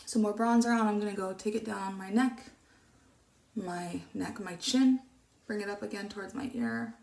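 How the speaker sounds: ordinary talk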